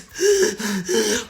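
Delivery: imitated gasping. Girl grabbing her throat over her brother, imitated gasp. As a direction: gasp as if no air will come. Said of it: "standing over him like this".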